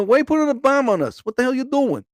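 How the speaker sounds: high-pitched mock indignation; playful high complaint; indignant cockroach. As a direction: protest as a put-upon roach, voice up.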